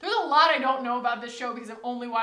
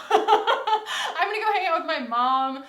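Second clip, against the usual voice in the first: laughing; 5 semitones higher, 10 dB louder, slower than usual. Real, not acted. Laughing about skipping a video.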